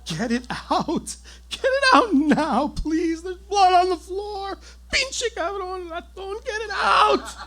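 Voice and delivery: high, loud, frantic pleading screams; hysterical screaming wife. Retold or imitated. Wife hysterically screaming about blood on the floor. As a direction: beg and curse him in a hysterical frenzy.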